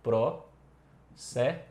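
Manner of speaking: PRO and SÉ as two separate syllables. Shown as a demonstration. Teacher sounding out a phonetic transcription, "Pro, sé" syllable by syllable. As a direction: break it into "Pro", pause, "sé". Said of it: "seria algo mais ou menos assim".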